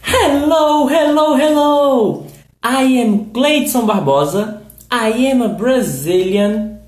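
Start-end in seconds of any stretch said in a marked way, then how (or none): 0.0-2.3 high, loud calling hello. Real, not acted. Host greeting the live audience, calling out. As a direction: call out as if checking anyone's there.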